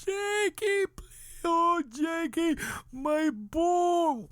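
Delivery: high, slow, bereft wailing; keen for a lost boy, comically overdone; hammed-up father's lament; mock crying. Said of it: "I'm crying".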